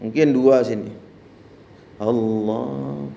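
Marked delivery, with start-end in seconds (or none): 2.0-3.1 soft drawn Allah from afar; lightly imitating an imam at distance; Imam Sudes imitation. Preacher imitating a distant imam, soft. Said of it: "suaranya kenceng itu".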